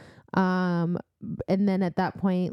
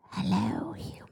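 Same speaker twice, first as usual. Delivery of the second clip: snake voice; 4 dB quieter than usual. Acted. Host tries a snake voice for the Naga, breathy hissing whisper. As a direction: breathe it out like a snake tasting prey. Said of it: "what kind of, like, accent do you think they have?".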